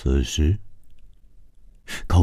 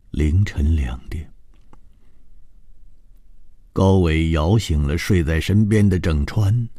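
usual speaking voice

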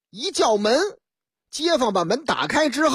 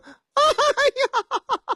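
sudden high scream down the phone; high woman's shriek; shrill scream. A narrator's reading. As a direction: cry out in shock. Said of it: "传出凄厉的叫喊之声".

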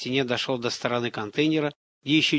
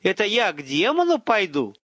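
higher-pitched dawning horror; incredulous question, slowing on the realization; shocked disbelief; 7 semitones higher, 3 dB louder than usual. A narrator's reading. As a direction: work it out aloud, stunned. Hero realizes he will be sacrificed.

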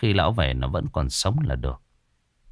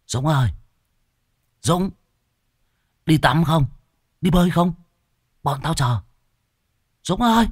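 high, raised call from outside; calling out to a friend; 9 semitones higher than usual. Acted. Friends at the gate calling Dũng out to swim, calling out. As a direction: call the boy out to play, pitched high.

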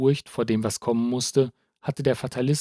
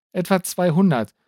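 ordinary delivery, nothing marked

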